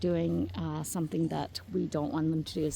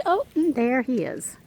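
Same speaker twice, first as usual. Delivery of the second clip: usual speaking voice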